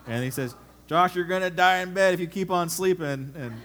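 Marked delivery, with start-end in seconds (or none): 0.9-3.3 loud laughing shout of a dad; dad's wake-up yell. Retold or imitated. Dad yelling at his sleeping son, loud and laughing. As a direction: bellow it like a dad hauling his son out of bed.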